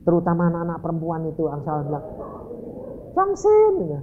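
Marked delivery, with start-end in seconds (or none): 3.2-3.7 high-pitched mock girlish call; put-on high taunting voice; teasing schoolgirls. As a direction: imitate girls teasing him. Girls teasing him by name.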